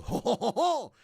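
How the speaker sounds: high mock-French chortle; French accent. Host capping the French bit, put-on French-accented laugh. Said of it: "That's my French accent".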